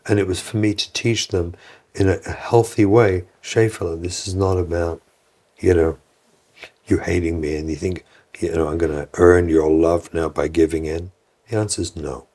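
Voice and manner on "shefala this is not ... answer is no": low, composed parental refusal; calm and firm. Retold or imitated. Parent refusing a child who is manipulating, calm and low. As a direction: hold the boundary in a low, calm voice. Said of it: "Your child knows if you're internally calm".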